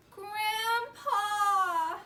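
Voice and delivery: loud, high wailing call. Acted. Both children calling for grandpa, loud high wail. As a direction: call out for help, upset.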